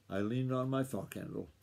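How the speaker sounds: plain reading voice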